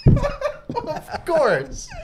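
high-pitched through laughter; amused, laughing agreement. Real, not acted. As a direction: crack up while agreeing. Laughing at the retold credit demand.

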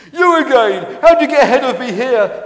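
high, loud outraged disbelief; startled shout. Acted. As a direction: shout at an unwelcome pursuer. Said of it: "he shouted".

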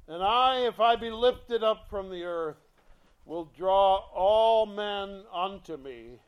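loud, ringing scripture reading; preaching scripture. Acted. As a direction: proclaim the verse to the congregation. Reads verse at full force.

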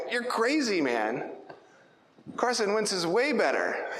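high-pitched, emphatic mock argument; bold sports opinion. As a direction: argue it like a cocksure football fan. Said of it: "I can be bold and be like".

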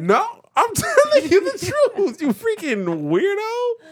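high-pitched indignant insistence; squealing protest at being doubted; exasperated. Real, not acted. Exasperated argument over the name.

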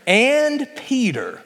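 "And Peter" drawn out slowly and lifted; emphasis on And Peter. Shown as a demonstration. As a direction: stretch "And Peter" so it lands. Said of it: "what are the next two words?".

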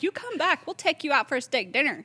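loud, high-pitched mock insistence; playful, hammed-up invitation; retold eager invitation. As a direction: act out eager suitors, voice up and loud. Retold boys eagerly inviting her out.

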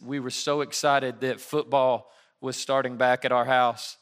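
usual speaking voice

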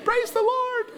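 very high, gleeful cheer; cheering kids. Retold or imitated. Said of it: "kids ... started cheering".

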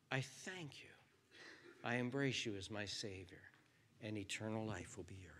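soft, hushed sinner's prayer; quiet prayer. Pretend. Preacher leading seekers in a hushed prayer. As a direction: pray it gently, half whispered.

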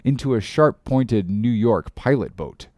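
ordinary delivery

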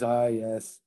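low, soft attempt at an Irish accent; badly faked Irish accent. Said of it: "that's my Irish accent".

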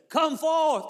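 high-pitched, full-voiced call; commanding shout. Jesus calling to every grave at once, commanding shout. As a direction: cry it out to the whole graveyard. Imagine Jesus had just said it.

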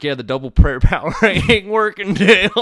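loud and high, cracking up mid-line; hammed-up believer, barely holding the laugh; laughing mock complaint. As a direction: mock-desperate caller to Dale, laughing through it. Prank caller complains to Dale.

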